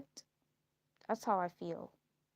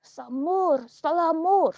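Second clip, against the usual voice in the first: loud, higher mocking yell of nonsense; mock shouting gossip; 5 semitones higher, 13 dB louder than usual. Mocking the woman who gossips about her, with a loud yell. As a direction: shout it like a jeering onlooker. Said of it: "that woman sitting there going".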